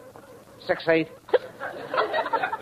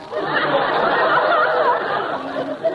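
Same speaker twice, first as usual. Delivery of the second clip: high breathy cackle. Acted. Laughing at own joke on phone.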